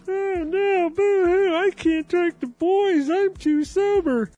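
very high, slow fake boo-hoo sobbing; overdone falsetto crybaby whine; mock crybaby. Reader mocking sisters who say they can't talk to boys, very high fake sobs.

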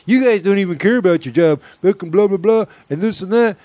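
high-pitched imitation of a ranting boss; mock scolding. Crew member imitating an overzealous boss's scolding, mock rant. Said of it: "came in there talking shit".

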